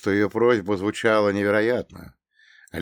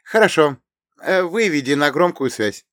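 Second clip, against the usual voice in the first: commanding heroine; 7 semitones higher, 7 dB louder than usual. Acted. Irina gives commands.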